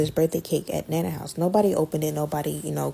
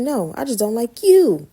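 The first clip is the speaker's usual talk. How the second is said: slow and loud, high; a teen defying an adult, slow and loud; blunt defiance; 6 semitones higher, 7 dB louder than usual. Retold or imitated. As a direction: lay it out slowly and loudly. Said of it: "I was like".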